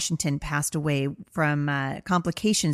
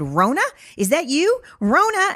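playful high call, much higher and louder, rising on Rona; 10 semitones higher, 5 dB louder than usual. Pretend. Mother playfully calling to scared daughter, high sing-song call. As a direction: call out Rona like hide and seek, soaring up high. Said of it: "And so I said".

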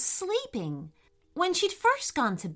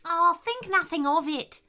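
higher, gracious character voice; echidna voice; 7 semitones higher than usual. A narrator's reading. Echidna excusing Mia's fright, lightly voiced character. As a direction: wave the apology away in character. Echidna reassures Mia in story.

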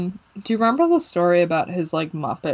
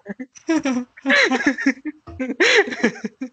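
laughing; 8 semitones higher, 5 dB louder than usual. Real, not acted. Laughing at a movie quote.

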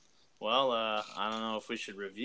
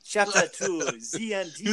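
high put-on announcer voice; Polish Panther voice; 8 semitones higher, 3 dB louder than usual. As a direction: grandly announce it in character. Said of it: "do a voice through this whole podcast".

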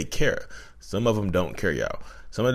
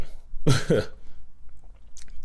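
breathy laugh; 8 semitones higher than usual. Real, not acted. Laughing at therapist's yikes.

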